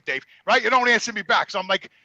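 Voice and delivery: normal talking voice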